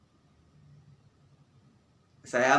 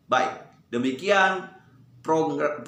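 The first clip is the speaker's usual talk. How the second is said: ordinary delivery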